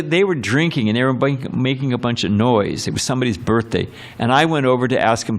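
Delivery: ordinary delivery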